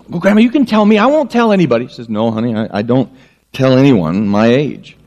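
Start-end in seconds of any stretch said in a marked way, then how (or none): 0.1-1.9 put-on high, eager child's voice; playful child voice, pitched up; little girl. Little girl pleads with grandma. 2.1-5.0 lightly voiced grandma, lower; a hint of an old woman, quick and dismissive; low, hurried granny voice.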